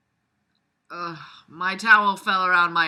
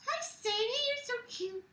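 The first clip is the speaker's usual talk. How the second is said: cooing at a pet; 8 dB quieter than usual. Real, not acted. Owner greeting her pet Sadie in soft baby talk. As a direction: coo sweetly to the pet.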